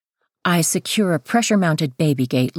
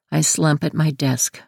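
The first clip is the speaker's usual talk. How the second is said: ordinary delivery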